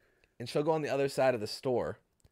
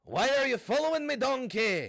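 pitched way up, strained Shrek attempt; second try at Shrek; 10 semitones higher than usual. Retrying Shrek after a B minus, higher and strained. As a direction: push the Shrek voice even higher. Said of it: "That got worse. Just louder".

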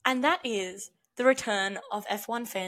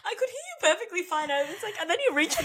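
high and giggling; amused reassurance; laughing; 10 semitones higher, faster than usual. Real, not acted. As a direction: tease her through laughter. Laughing at friend.

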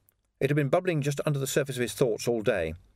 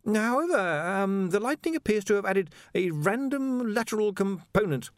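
high, stammering anxious scholar; a jittery young wizard stammering high; nervous wizard; 10 semitones higher than usual. A narrator's reading. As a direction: stumble over every word, flustered. Ponder explains.

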